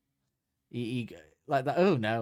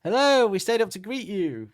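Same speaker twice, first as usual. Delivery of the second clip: sung out high and loud, sweetly eager; high mock romantic greeting; 10 semitones higher, 6 dB louder than usual. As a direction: greet the sun like a smitten fan. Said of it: "Doctor Hook's like".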